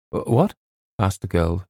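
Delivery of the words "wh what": higher, stammered question; startled. A narrator's reading. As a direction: stammer it in surprise.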